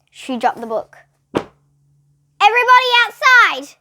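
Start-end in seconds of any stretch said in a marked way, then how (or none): 2.4-3.8 much higher, louder urgent command; ordering. A narrator's reading. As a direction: order the class out now. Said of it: "she ordered".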